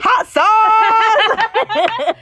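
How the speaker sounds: high laughing blurt; laughing shout. Real, not acted. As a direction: yelp it out mid-laugh. Laughing punchline between friends.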